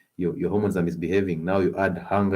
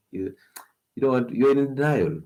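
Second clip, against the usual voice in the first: ordinary talk, nothing marked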